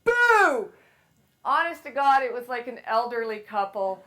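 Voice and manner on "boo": sudden high shouted boo; a scare yell from behind the door; big boo. Retold or imitated. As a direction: leap out yelling to scare. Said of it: "I went".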